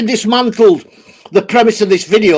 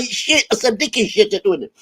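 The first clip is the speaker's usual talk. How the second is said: ordinary delivery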